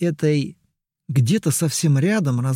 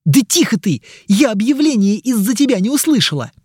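irritated shout; 7 semitones higher, 5 dB louder, faster than usual. Acted. Mari snaps during announcement.

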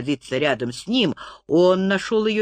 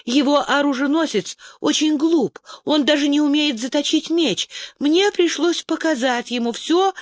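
put-on higher girlish voice, eager to explain; lightly voiced young woman, hurried excuses; Zarit, excusing herself; 8 semitones higher than usual. A narrator's reading. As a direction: defend yourself to your angry brother, pitched up. Zarit justifying herself to brother.

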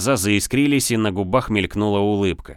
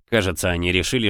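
plain reading voice

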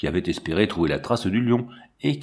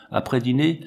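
ordinary delivery, nothing marked

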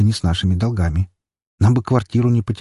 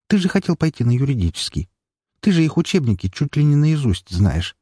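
higher-pitched sister voice, insistent; urging sister; 6 semitones higher than usual. Acted. Sister reminds her brother of his law school plan, urging in a higher voice. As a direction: push him, in a raised-pitch sister voice.

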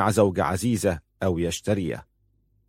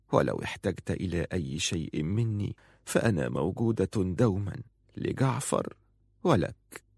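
soft, tentative woman's voice; gentle deference; 7 dB quieter than usual. A narrator's reading. Woman offers her lord support, soft and deferential. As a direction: offer comfort quietly and humbly.

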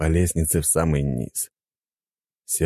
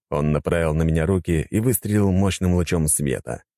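plain reading voice

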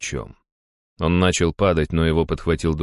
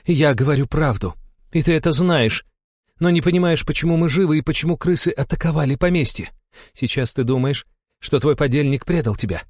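high, cool, relentless woman's voice; cold accusation; 10 semitones higher than usual. A narrator's reading. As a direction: lay out the threat coldly, point by point. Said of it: "холодно протянула Алла". (холодно, обличающе)

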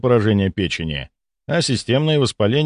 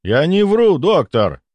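mildly indignant, higher-pitched protest; 8 semitones higher, 4 dB louder, slower than usual. A narrator's reading. Patient rejects the doctor's suspicion, mildly indignant. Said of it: "возмутился пациент".

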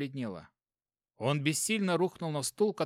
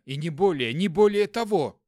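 panicked insistence; 4 semitones higher, 7 dB louder than usual. Acted. Panicked self-justifying thought.

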